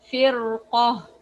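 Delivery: the ra in فرقة said heavy with a full mouth. Shown as a demonstration. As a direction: give فرقة a thick, full ra. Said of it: "in a heavy way".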